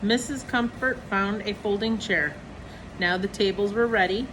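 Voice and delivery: usual speaking voice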